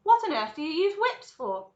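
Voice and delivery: high, loud baffled question; incredulous cry. A narrator's reading. Veruca demands to know what whips are for, incredulous. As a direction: demand an explanation. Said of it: "cried Veruca Salt".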